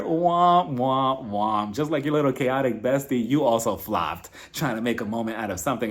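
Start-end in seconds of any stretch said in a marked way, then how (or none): 0.0-1.8 slow, descending "womp, womp, womp"; drawn-out falling trombone imitation; sad trombone womps. Shown as a demonstration. Mocking Heather's flopped moment.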